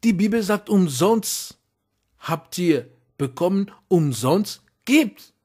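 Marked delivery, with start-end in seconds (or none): none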